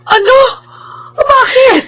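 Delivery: disbelief breaking into tears; shocked and tearful. Acted. Mother hearing her daughter was thrown out, shocked.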